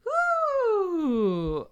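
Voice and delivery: high excited woo; excited whoop for the donor; celebratory woo. Real, not acted.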